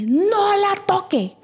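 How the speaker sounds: imitating an irritated mother; mock stern scolding. Angry mother warning her off her daughter, mock stern.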